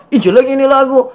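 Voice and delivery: high, sneering imitation of a hater; lightly voiced scornful critic; mock hater. Host imitating haters mocking the song. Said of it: "pasti banyak yang hina".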